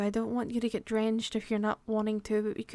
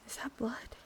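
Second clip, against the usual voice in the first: soft, fearful whisper; hushed dread; horrified whisper; 13 dB quieter than usual. Acted. Noticing blood on partner.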